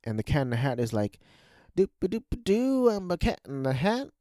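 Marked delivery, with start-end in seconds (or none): 1.8-4.1 high, bouncy goofball voice; hammed-up singsong prancing; Cat in the Hat. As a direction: play a silly, carefree cartoon figure. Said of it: "basically the anti-Grinch".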